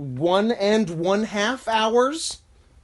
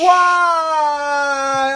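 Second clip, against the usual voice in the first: hammed-up anguished wail; high, loud, drawn-out crying protest; Nancy Kerrigan; 10 semitones higher, 7 dB louder than usual. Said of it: "you turned me into Nancy Kerrigan".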